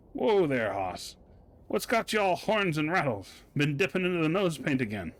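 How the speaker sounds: a hint of an old Western sidekick; cowboy talk. Reading the cowboy character's lines in a light Western voice. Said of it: "Gabby doesn't talk like that".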